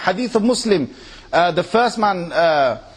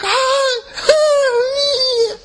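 much higher squeaky mock fangirl hello; hammed-up giggly swooning hi; bubbly giggly girl. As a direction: imitate a starstruck girl greeting her idol. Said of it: "all bubbly and giggly".

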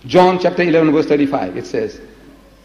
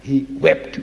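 ordinary talk, nothing marked

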